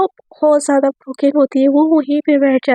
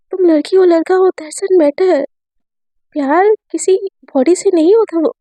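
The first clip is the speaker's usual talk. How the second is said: plain reading voice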